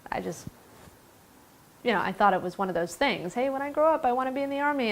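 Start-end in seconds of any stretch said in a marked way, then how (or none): 3.3-4.9 lightly voiced eager child, higher pitch; childhood wish. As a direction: toss it off like a kid's big plan, pitched up. Voicing a childhood career wish.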